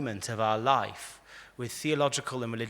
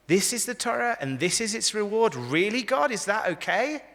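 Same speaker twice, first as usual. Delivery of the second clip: high, louder, disbelieving questions; indignant challenge; 8 semitones higher, 5 dB louder than usual. Acted. Moses challenges God over Akiva's martyrdom, indignant and high. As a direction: demand an answer, incredulous and raised.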